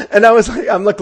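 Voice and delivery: ordinary talk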